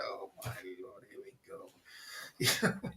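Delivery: low breathy whisper, slowed right down; a slow, shocked whisper; hushed mock dismay. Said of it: "It's like".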